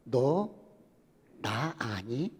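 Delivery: low, slow divine voice; deep, slow voice of God questioning the professor; God's voice. Acted. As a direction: ask it slowly and low, as God to his servant. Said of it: "하나님께서 물어보는".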